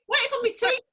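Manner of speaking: high-pitched, hammed-up Yorkshire; Yorkshire accent. Shown as a demonstration. Woman repeating a Yorkshire phrase for laughs, broad Yorkshire accent. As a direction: raise the pitch and play up the Yorkshire. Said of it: "It's proper Yorkshire".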